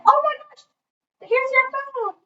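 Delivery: very high, mock-astonished discovery; feigned surprise. Pranksters pretend to find her phone, feigned surprise.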